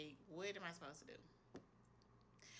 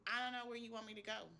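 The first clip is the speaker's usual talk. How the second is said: pleading higher, a touch louder at the end of her rope; emotional plea; 6 semitones higher, 3 dB louder than usual. Retold or imitated. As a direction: cry out to God in frustration, voice lifting. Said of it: "I was just emotional".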